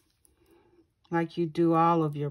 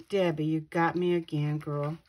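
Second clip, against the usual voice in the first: usual speaking voice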